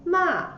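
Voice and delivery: "Ma" starting high and falling sharply; fourth tone. Shown as a demonstration. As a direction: say "Ma" in Mandarin fourth tone, dropping down. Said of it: "The tone goes down".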